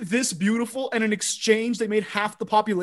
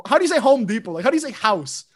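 normal talking voice

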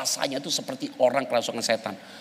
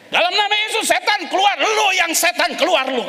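retold yelling at a demon, louder and higher; mock shouted exorcism; 3 dB louder than usual. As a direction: yell it in the name of Jesus. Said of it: "kata dia gitu". (teriakan pura-pura mengusir setan)

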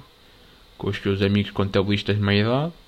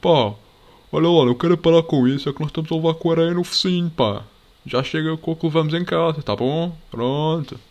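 loud, higher put-on voice, complaining; annoyed girl; 7 semitones higher, 5 dB louder than usual. Imagined girl telling the lads to stop throwing sand, loud mock scolding. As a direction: scold them like a fed-up beach girl. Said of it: "elas me podiam vir dizer tipo".

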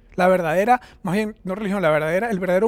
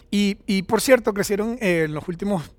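ordinary delivery

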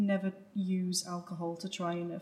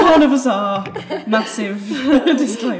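laughing; 3 semitones higher, 17 dB louder than usual. Real, not acted. Group laughs at disclaimer joke.

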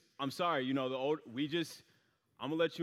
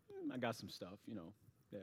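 evasive mumble; 5 semitones lower, 13 dB quieter than usual. Retold or imitated. Dodging friend's question.

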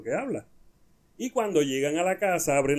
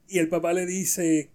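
ordinary delivery

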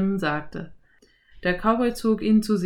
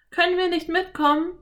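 put-on high girl voice, smiling; a smiling little girl answering; Anne, smiling; 11 semitones higher, faster than usual. A narrator's reading. Anne asks. (Mädchenstimme, lächelnd)

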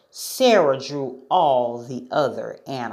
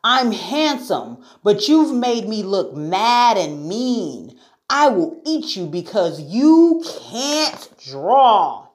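offended lion, loud and higher, drawn out; acted indignant lion outburst; growling lion's anger; 7 semitones higher, 5 dB louder than usual. A narrator's reading. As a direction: build to a slow, loud threat. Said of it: "he growled".

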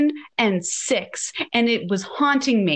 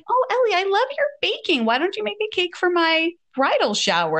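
sugary bride; 7 semitones higher than usual. Retold or imitated. Bride voiced sweetly.

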